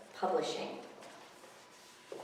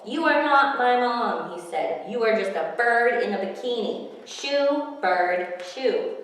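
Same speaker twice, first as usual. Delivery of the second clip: put-on fed-up kid; 5 semitones higher, 9 dB louder than usual. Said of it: "getting tired of this".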